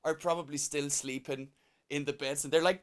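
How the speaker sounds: ordinary delivery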